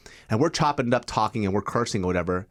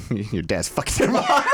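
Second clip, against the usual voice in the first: laughing; slower than usual. Real, not acted. Joking insult, laughing.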